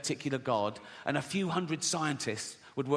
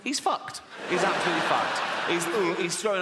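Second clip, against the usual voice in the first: laughing; 3 semitones higher, 3 dB louder than usual. Real, not acted. Laughing at own joke.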